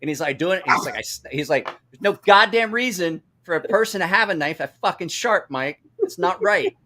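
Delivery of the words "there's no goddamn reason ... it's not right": high, indignant mock complaint; retold outrage. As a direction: imitate an aggrieved friend, higher pitched. Retold injured friend's angry complaint.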